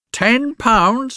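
put-on old woman screaming at a paltry offer; screamed outrage. A narrator's reading. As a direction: scream the offer back in disbelief. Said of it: "she screamed and raved at him".

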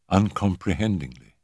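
usual speaking voice

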